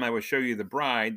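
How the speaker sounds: normal talking voice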